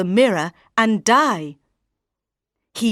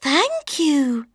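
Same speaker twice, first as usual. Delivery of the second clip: lightly voiced sweet girl; polite young girl thanks; a hint of an innocent young girl; 6 semitones higher than usual.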